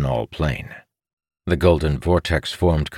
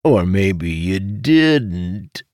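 very drawn-out, higher-pitched tease; sly insinuation; 5 semitones higher, 4 dB louder, much slower than usual. A narrator's reading. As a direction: stretch it slowly, hinting knowingly. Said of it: "then snickered".

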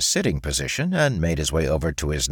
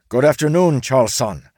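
higher, louder happy greeting; cheerful samurai; 7 semitones higher, 6 dB louder than usual. A narrator's reading. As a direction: samurai waving happily at Charles.

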